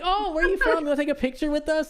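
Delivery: hammed-up girls pinning him to a wall; mock girly voice. Girls voiced in retold story.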